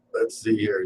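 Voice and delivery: ordinary delivery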